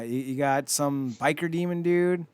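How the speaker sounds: normal talking voice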